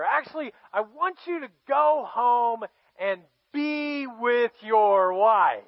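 high, loud, gushing host; butter up the guest with fake enthusiasm; effusive royal flattery, stretched for emphasis at the end; overdone welcome. David falsely welcomes Uriah.

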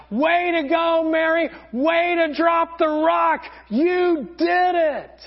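high, loud, slow cheer. Retold or imitated. People on the boat cheering Mary as she arrives, high loud cheer. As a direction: celebrate a friend who made it. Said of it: "They're all cheering".

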